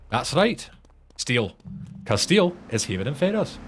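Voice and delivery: put-on high, lilting Scottish accent; confident answer in mock Scots; Limmy. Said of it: "quoting a Limmy show".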